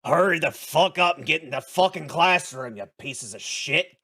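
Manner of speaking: a fed-up teacher yelling at students. Acted. Teacher yelling at late students, loud and fed up. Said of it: "He yells at all of you and says".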